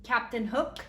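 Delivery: usual speaking voice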